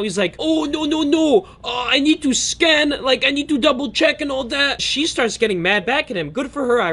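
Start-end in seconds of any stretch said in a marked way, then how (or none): 0.4-4.8 much higher, panicky put-on voice for the dealer; freaked-out dealer. Dealer panicking at the lady moving his boxes, high and frantic. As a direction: squeal it like a flustered man caught out. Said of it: "he starts getting freaked out".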